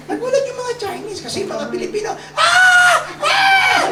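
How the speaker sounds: high excited "Ah! Ah!" of starstruck fans; mock fan squeals; starstruck gasps. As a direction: squeal like fans spotting a star. Filipinos gawking at celebrity.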